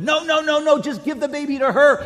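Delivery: high, loud pleading mother's cry; desperate plea. Retold or imitated. True mother begging Solomon not to cut the baby, high and pleading. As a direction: beg the king to spare the child.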